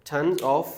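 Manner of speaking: tons of joined as "tonzov"; linked tons of. Shown as a demonstration. Teacher showing consonant-vowel linking in tons of. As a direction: run tons into of with the z. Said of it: "ये देखें linking कैसे हुई".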